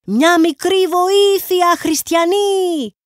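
high, slow whining beggar's cry; beggar's plea. A narrator's reading. Ragged beggar begging the theatre crowd, high slow whine. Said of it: "φωνάζοντας".

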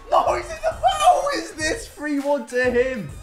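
ordinary talk, nothing marked

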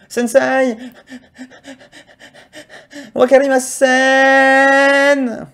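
high, very slow, stretched-out puzzled student voice; drawn-out mock confusion in class; clueless student. Shown as a demonstration. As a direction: play the dim pupil to annoy the teacher. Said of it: "vous levez la main comme ça et vous faites".

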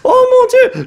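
excited. Real, not acted. Reacting to pack opening.

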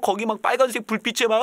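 usual speaking voice

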